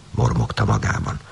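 ordinary delivery, nothing marked

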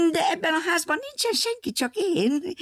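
lightly voiced high, quick old lady; a sly little high-pitched woman; high woman's voice. A narrator's reading. Woman in the house speaks.

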